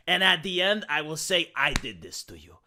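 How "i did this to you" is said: much lower, quieter sinister line; mock villain growl. Telling the studio at the end, low and softer mock-villain gloat. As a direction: deliver it like a gloating villain's last word.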